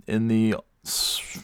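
ordinary talk

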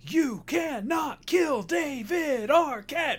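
high, slow, shouted proclamation; dramatic title announcement. Pretend. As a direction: boom it out like a movie trailer. Dramatic movie title reveal.

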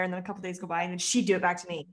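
ordinary talk, nothing marked

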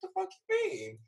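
high-pitched retold bafflement; incredulous. Recovering patient hears ominous office offer, incredulous. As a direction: squeal it in exaggerated disbelief.

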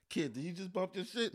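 hushed, half-muttered question; quiet disbelief. Retold or imitated. To the driver who bumped his car, hushed. Retold car bump, first calm take.

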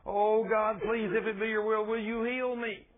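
high, pleading mock prayer; begging prayer. Preacher imitating believers begging God for healing, pleading. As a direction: act out someone begging on one sustained high note. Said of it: "Why do we go around begging".

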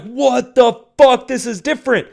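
high retold astonishment, stretched then dropping; freaking out. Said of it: "And I'm freaking out".

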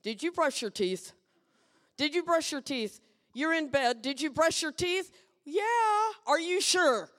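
nagging parent. Retold or imitated. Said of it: "how about this one?".

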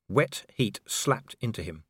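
usual speaking voice

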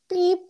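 high-pitched vocal beep; little "beep" sound effect; editing beep. Real, not acted. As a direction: make a short high beep as a cut marker. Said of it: "I'll just make, like".

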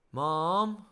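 high, loud call down the hall; child calling mum through a closed door. Retold or imitated. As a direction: call her from another room.

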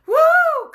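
high, loud whoop of joy; joyful whoop. Real, not acted. Preacher cheering herself on, a high loud woo. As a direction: let out a jubilant woo. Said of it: "I'm gonna get myself excited".

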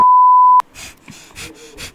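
loud high-pitched laugh burst; shrieking laugh. Real, not acted. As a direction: squeal with laughter. Laughs after being stabbed.

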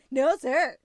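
eager to please the officer; nervous. Retold or imitated. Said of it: "like, so nervous".

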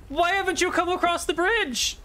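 very high-pitched villainess calling across a distance; high, challenging spellcaster voice; enemy spellcaster. Acted. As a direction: demand it in a high character voice. Game master voices the enemy spellcaster.

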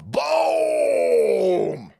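a triumphant boom; excited shout. Real, not acted. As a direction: cheer it out like a winner. Celebrating lawsuit win.

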